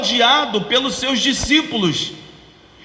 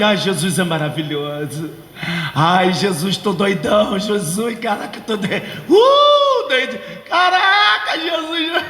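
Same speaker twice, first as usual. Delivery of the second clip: dazed doped-up worshipper; stoned; 2 semitones lower than usual. Pretend. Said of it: "todo mundo dopadinho".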